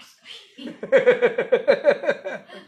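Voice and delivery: high, loud rolling laugh; loud laughter. Real, not acted. Guru laughing at the lady who can't get away, loud. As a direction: laugh long and hard.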